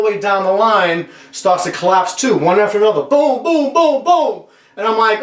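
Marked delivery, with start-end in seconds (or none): 3.0-4.4 high, rhythmic toppling booms; imitated chain of booms. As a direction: mimic stacks crashing one by one. Imitating stacks toppling in turn.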